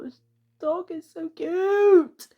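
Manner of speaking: high, loud and slow, gushing; gushing over a dog. Real, not acted. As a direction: stretch out so cute in delight.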